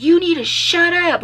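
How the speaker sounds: imitated cop snapping high and loud; rude shut up. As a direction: bark it like a bullying officer. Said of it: "Like, he says that".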